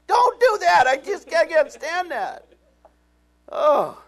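high, loud anguished protest; pleading shout. Retold or imitated. Pastor begs God not to bless him, shouting high. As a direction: shout it like begging to stop.

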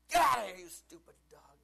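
loud shout chasing off the dog; screaming at the dog. Retold or imitated. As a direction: yell it at the dog to get out. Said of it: "scream at my dog".

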